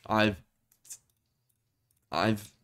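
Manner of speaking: halting restarts on I've; stuttering. Real, not acted. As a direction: stumble over the first words. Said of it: "sorry, I'm stuttering".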